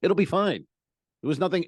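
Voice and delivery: ordinary talk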